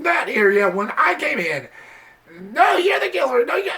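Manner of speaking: high, whiny mock bickering; mocking the characters. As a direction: sneer out the accusations in a high squeal. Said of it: "talking and talking and".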